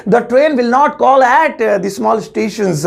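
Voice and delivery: slow, deliberate teacher model sentence; slow careful English. Shown as a demonstration. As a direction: spell the English out slowly for students. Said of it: "तो English होगी".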